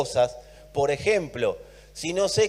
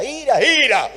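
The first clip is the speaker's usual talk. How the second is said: higher and louder, hammering ira; mock emphasis; 8 semitones higher, 6 dB louder than usual. Real, not acted. Preacher mocking spiritualized readings, sarcastic emphasis. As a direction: ridicule the search for hidden meaning.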